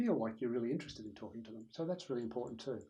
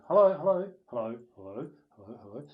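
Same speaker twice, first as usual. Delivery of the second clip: practicing the voice; 11 dB louder than usual. Shown as a demonstration. Speaker practicing in an empty lecture theatre, volume stepping down. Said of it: "just practicing the voice".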